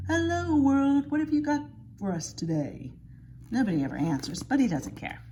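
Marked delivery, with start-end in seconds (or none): 0.1-2.9 real loud voice. Retold or imitated. Grandpa greeting the world from the window, loud and booming. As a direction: call it out grandly to the whole world. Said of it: "in a real loud voice".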